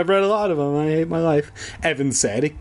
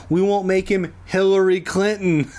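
laughing at own joke; 6 semitones higher than usual. Real, not acted.

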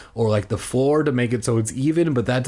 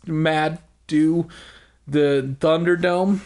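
ordinary delivery

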